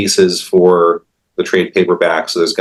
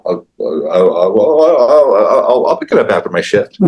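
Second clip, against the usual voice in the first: stammering excuse. Retold or imitated. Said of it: "the guy was like".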